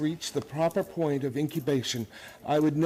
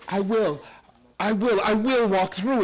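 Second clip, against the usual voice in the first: rising alarm; 5 semitones higher, 4 dB louder than usual. Acted. Argument escalating at the wall.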